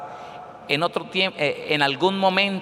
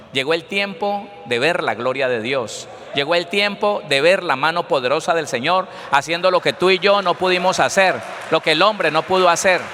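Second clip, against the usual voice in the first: building to a loud sermon climax; rousing preacher's proclamation; full-force preaching; 3 semitones higher, 4 dB louder than usual. Real, not acted. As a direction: proclaim it to the congregation, rising into a shout.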